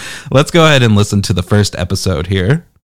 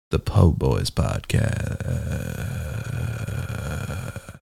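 a touch lower, breathy-free announcer imitation of the intro; lightly mocking deep announcer; 6 semitones lower, 11 dB quieter, much slower than usual. Host imitating the guest's intro voice, a little lower. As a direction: croon the show name like a smooth radio voice. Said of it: "What a sexy man".

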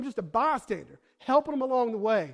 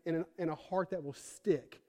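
ordinary talk

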